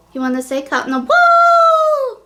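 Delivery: high, loud coaxing voice. Real, not acted. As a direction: coax the dog to join in. Asks her dog to sing it.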